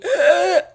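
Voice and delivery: high, loud panicked gasp; acted sharp gasp. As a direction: gasp as if choking for air. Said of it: "and then I start doing noises".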